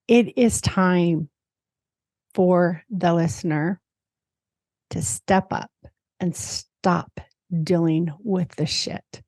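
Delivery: slow, deliberate, every phrase weighted; strong, emphatic message. Acted. Host delivering the card's message to listeners, slow and firm. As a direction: lay it down firmly, phrase by phrase. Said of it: "How's that for pretty strong?".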